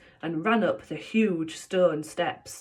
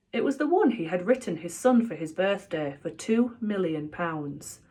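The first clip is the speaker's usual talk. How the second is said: plain reading voice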